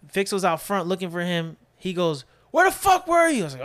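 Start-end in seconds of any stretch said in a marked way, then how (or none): 2.5-3.4 very high, loud retold shout; exasperated yell. Fixo to Dylan returning, exasperated yell. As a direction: shout it like confronting the missing man.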